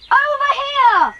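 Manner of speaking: high, slow shout across the field; an excited child's call across open ground. Acted. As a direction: call him over, stretching it out. Child calls sibling to the tunnel.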